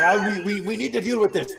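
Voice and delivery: high, hurried panic; flustered and rushing, voice way up; panicked. Acted. Donovan panics at the party over the fire. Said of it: "He's like".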